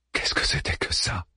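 whispered, stunned question; a soft, shaken whisper. A narrator's reading. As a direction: breathe it out, still in shock. Harman shaken, livid.

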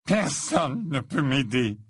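slow, understated tearful voice; trembling despair. A narrator's reading. Crying boy refusing the ghost's help, trembling. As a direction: draw it out, close to tears. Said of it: "répondit Malfoy, le corps tremblant".

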